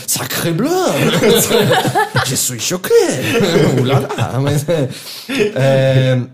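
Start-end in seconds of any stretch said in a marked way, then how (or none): 0.1-4.4 mock French, shocked and laughing; hammed-up French outrage; French accent. Imita francês chocado, rindo. (sotaque francês debochado rindo)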